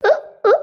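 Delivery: high, short gagging noises; choking grunts. Acted. Wife waits for water, choking. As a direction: choke while waiting for water.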